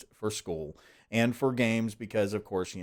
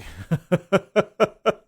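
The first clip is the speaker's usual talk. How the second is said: burst of laughter; 12 semitones higher, 8 dB louder than usual. Real, not acted. Laughs at own busy week.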